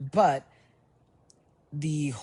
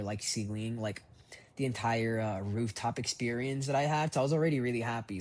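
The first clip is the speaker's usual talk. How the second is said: normal talking voice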